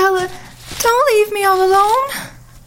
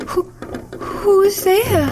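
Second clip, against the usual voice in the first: ordinary delivery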